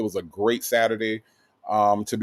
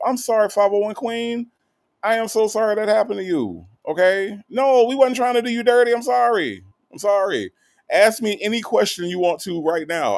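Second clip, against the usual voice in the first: effusive apology; 11 semitones higher than usual. Real, not acted. Host apologizing to a viewer who felt ignored, high and effusive. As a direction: apologize profusely, pitched way up.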